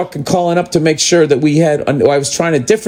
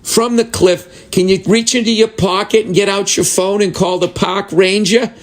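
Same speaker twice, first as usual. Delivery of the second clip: high, measured put-on service voice; unhelpful service worker; 6 semitones higher than usual. Service worker advising someone dangling from a cliff, mock officious.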